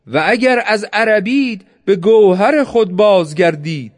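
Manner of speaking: declaimed shout, controlled in volume; calling out. A narrator's reading. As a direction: call out in rebuke across the battlefield. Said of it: "حسین بانگ زد".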